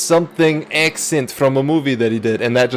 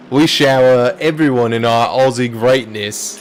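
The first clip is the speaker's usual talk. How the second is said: mock Aussie commentator; 2 semitones lower than usual. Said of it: "with as much American accent as".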